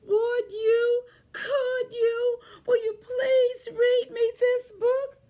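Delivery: high, loud begging mouse voice; pleading mouse. Acted. Mouse pleading with Duncan to read the book, high and loud. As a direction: beg like a tiny eager mouse.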